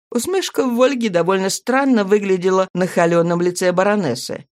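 ordinary delivery

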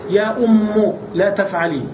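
"أم" said "ummu", ending in u; damma ending. Shown as a demonstration. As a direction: say "يا أم" slowly, closing on u. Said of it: "ضم الحرف الذي قبل".

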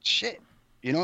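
ordinary talk

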